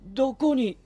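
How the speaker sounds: high, loud, alarmed call; startled shout. Real, not acted. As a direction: jump at a voice out of nowhere. Startled by an unseen voice.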